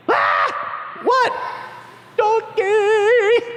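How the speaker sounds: high, stunned shout of discovery; startled disciple. Acted. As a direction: yelp out the word Donkey. Disciple spots the donkey.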